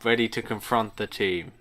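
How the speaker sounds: usual speaking voice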